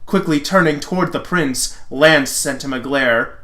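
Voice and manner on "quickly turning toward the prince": ordinary delivery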